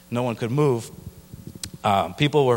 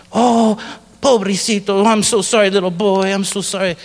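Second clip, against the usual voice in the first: high, louder mock pity, cooing over the child; imitating sympathetic girls; 5 semitones higher, 5 dB louder than usual. Two girls fussing over the boy, high mocking pity. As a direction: coo "pobrecito" like a passerby fussing. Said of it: "they're like".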